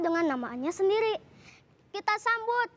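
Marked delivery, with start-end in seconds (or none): 1.9-2.7 drawn-out announcer welcome. Acted. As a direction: stretch the name like a show host. Host announces celebrity guest.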